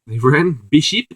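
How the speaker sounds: light South African accent impression. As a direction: Alan Partridge-style South African accent. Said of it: "doing his South African accent".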